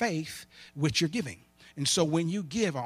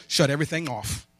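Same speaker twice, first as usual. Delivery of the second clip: usual speaking voice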